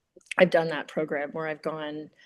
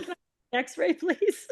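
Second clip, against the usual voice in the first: meek request; 12 semitones higher than usual. Retold or imitated. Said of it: "I'm like".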